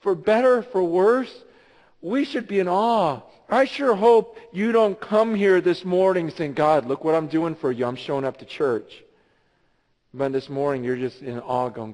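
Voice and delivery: loud, high-energy sermon; impassioned pastor; preaching. Acted. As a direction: drive the message home to the church. Preaches at full force.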